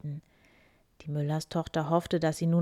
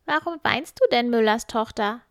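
higher, louder, impish little man; 6 semitones higher, 8 dB louder than usual. Acted. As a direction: peer at her like a curious imp.

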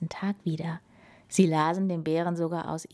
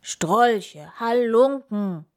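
loud, drawn-out roar; angry storybook bear shout; 4 semitones higher, 7 dB louder, much slower than usual. A narrator's reading. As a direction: bellow slowly at the intruders. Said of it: "brüllte der Bär".

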